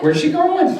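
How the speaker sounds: high, loud, laughing question; mock bewilderment. Therapist as girl runs off, high comic surprise. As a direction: blurt it out high, half laughing, baffled.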